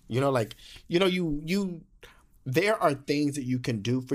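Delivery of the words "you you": normal talking voice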